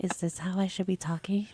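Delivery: soft ASMR whisper. Shown as a demonstration. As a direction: breathe it in a hushed ASMR whisper. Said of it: "talking in my A S M R voice".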